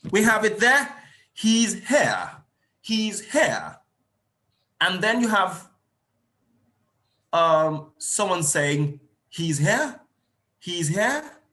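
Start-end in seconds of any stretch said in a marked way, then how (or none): 1.3-3.8 HERE lifted, then dropping sharply as a statement; emphatic falling tune on HERE; statement intonation. Shown as a demonstration. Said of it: "Mine is a statement". 9.3-11.4 HERE swooping upward like a question; rising tune on HERE; questioning intonation. Shown as a demonstration. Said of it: "but different intonation".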